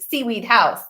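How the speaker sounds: usual speaking voice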